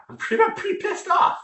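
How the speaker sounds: high, strained irritation without shouting; real anger held at normal volume; worked up, pissed off. Real, not acted. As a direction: pitch jumps up as anger rises. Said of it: "I'm getting a little worked up".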